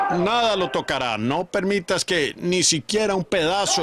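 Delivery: booming divine decree; commanding order. Acted. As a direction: order the host with power, slowing down. God commands the angels.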